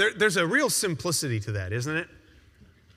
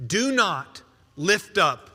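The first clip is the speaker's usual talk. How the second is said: slow declaration; 2 semitones lower, slower than usual. Shown as a demonstration. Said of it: "scripture declaimed slowly".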